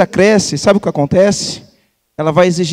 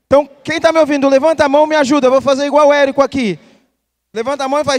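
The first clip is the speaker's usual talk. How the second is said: hype; 7 semitones higher, faster than usual. Real, not acted. Hyping the crowd again.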